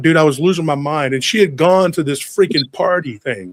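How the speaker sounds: usual speaking voice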